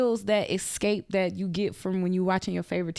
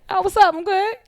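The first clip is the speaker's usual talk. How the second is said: high, loud breezy greeting; casual friend; 12 semitones higher, 7 dB louder than usual. Retold or imitated. Friend answering her call, high and breezy. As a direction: toss it off carefree.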